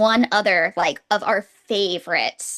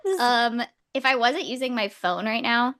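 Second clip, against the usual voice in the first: usual speaking voice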